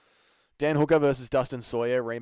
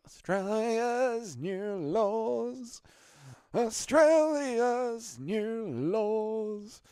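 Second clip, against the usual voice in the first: mock dramatic announcement; 10 semitones higher, much slower than usual. Mock dramatic title read.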